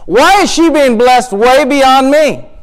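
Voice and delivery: high, slow, aggrieved question; protesting to God. Acted. Hannah asking God why her rival is blessed, exasperated protest. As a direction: stretch it out as an exasperated plea. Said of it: "And she says".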